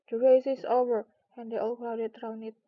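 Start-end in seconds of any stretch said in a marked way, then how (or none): 0.1-1.1 loud announcing call. A narrator's reading. Dodo announcing the race's end, called out loud. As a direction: call it out to the whole party. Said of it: "suddenly called out".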